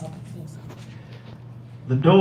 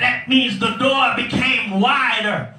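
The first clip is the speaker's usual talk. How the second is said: preaching at full force; 6 semitones higher, slower than usual. Acted. Preacher lifting the congregation to the big blessings. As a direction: whoop it up like a revival preacher.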